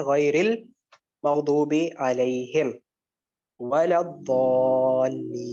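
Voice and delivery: "غیر المغضوب علیہم ولا الضالین" drawn out very slowly, long vowels held; very slow, chanted tajweed recitation. Shown as a demonstration. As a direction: recite it like Quran qira'at with every madd stretched. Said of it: "اب یہاں پر کیا ہے".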